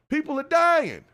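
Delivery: high, loud stab of a line; urgent outcry. Real, not acted. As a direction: make it land hard. Urgent point in rant.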